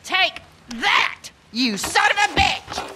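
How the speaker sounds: angry fighting cry; fierce shout. Acted. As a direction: strike and yell at him. Woman fighting off attacker.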